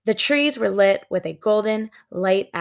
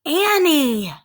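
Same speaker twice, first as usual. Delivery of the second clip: loud, higher call; 8 semitones higher, 4 dB louder than usual. Acted. Brother calling sister in treehouse, loud call.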